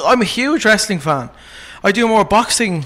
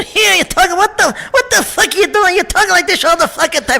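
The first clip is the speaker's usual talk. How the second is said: much higher, rapid New York gangster rant; fast, foul-mouthed wiseguy tirade; Joe Pesci impression; 7 semitones higher than usual. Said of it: "Pesci's my favorite to do".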